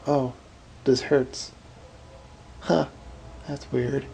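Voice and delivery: note the pain casually, as a mere curiosity; mock understated reaction. Shown as a demonstration. Showing how nobody reacts to real pain, mock casual remark. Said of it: "You just don't go".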